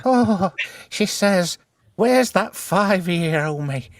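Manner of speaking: channel a spirit like a medium; seance medium. Pretend. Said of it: "I'm channeling the spirit of Winnie Mandela".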